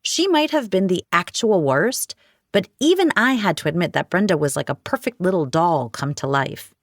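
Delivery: ordinary delivery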